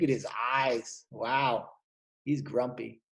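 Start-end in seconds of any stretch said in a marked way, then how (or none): none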